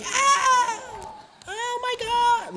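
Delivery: very high mock scream, drawn out; hammed-up squeal of terror; imitated screaming. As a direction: imitate a panicked crowd shrieking. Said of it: "like, screaming".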